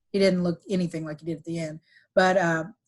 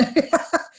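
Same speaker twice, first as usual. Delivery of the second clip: light laugh; 9 semitones higher than usual. Real, not acted. Laughing at the memory.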